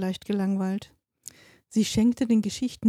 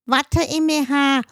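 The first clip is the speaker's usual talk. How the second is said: put-on a little boy's high, loud blurt; toddler bellowing; 7 semitones higher, 6 dB louder than usual. A narrator's reading. Toddler blurting out his sister's secret, high and loud. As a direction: yell it out to grab everyone's attention. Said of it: "brüllte er plötzlich los".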